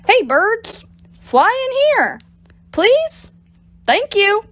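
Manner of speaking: high, sing-song coaxing call; calling sweetly to birds. Acted. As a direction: sweet-talk the birds from below. Bob calls up to birds.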